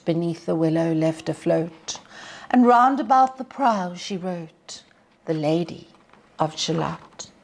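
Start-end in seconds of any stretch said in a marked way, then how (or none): none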